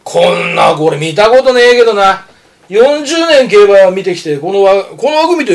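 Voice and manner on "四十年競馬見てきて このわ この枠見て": ordinary talk, nothing marked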